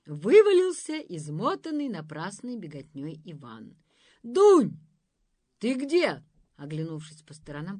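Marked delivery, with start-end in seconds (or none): 4.2-6.2 loud searching call. A narrator's reading. Ivan calling for Dunka. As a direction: call across the clearing. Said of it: "позвал он".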